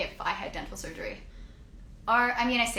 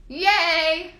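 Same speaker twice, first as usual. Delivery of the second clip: an awkward, loud, high cheer; weird yay; 6 semitones higher, 6 dB louder than usual. Real, not acted. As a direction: cheer a bit too hard and land oddly. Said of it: "that was a weird yay".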